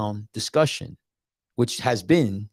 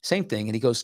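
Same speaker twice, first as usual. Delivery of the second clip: usual speaking voice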